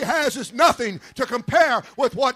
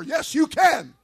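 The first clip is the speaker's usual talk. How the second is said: normal talking voice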